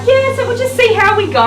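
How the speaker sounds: high, loud, airy mock-casual voice; playful high voice shrugging off a big dog; breezy mock confidence. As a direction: brush it off way up high. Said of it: "I'd be like".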